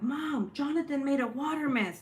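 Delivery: high-pitched imitation of a tattling son; tattling kid voice. Son telling mom about the mess, high tattling voice. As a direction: voice a child running up to tell on someone.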